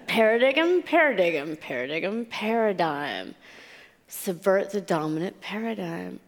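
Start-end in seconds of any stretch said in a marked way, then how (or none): 0.1-2.3 "paradigm" tried out as para-dig-um; mispronounced paradigm. Retold or imitated. Newcomer works out an unknown word, repeating "paradigm" as para-dig-um. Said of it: "I read it like". 2.3-3.3 "paradigm" drawn out slowly as para-dime; working out pronunciation. Retold or imitated. Newcomer finally gets the word, slow "paradigm" as para-dime. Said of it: "I read it like".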